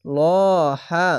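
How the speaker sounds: ল held with a thick o before হা; long heavy vowel. Shown as a demonstration. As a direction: draw out a rounded ল, then হা. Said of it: "এখানে মোটা করে পড়তে হবে". (ল মোটা করে এক আলিফ টেনে)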